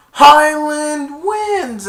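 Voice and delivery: high, loud, long-drawn victory call; stadium announcer. Retold or imitated. Announcer calling Highland's win, drawn-out shout. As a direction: stretch each word over the stadium. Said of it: "The announcer was like".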